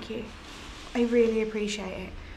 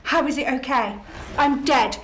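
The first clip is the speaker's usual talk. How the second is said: normal talking voice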